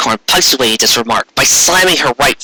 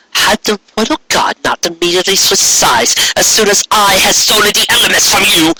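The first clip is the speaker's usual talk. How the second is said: higher, lofty indignant speech; haughty queen arguing; 7 semitones higher than usual. Acted. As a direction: argue back with royal disdain. Corona's argument.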